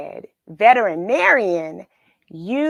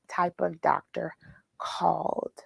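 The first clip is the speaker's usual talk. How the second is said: whisper; 4 semitones lower, 7 dB quieter than usual. Acted. Quiz question to kids.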